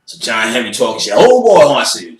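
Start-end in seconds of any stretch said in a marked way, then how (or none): 1.1-1.7 put-on high, loud taunting oh boy; mocking oh boy. Homeboy taunting him, a high, loud mocking oh boy. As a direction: sneer it out high.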